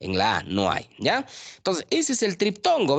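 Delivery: normal talking voice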